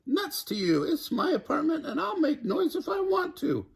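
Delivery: high taunt with a laugh; shrieked taunt. A narrator's reading. Nightingale defying Alligator, high laughing taunt. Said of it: "shrieked Nightingale".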